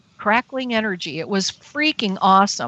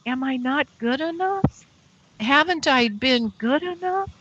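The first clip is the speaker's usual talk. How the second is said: hurt pleading; 5 semitones higher than usual. Retold or imitated. Retells asking departing aliens aloud.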